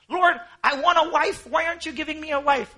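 high, loud demanding whine at God; whiny complaint. Pretend. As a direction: beg God like a spoiled child. Voicing a complaining believer.

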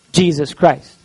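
ordinary talk

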